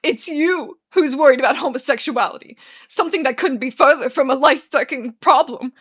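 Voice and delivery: loud, tearful accusation; distraught accusation. Acted. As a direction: throw the blame back loudly. Arthur weeps.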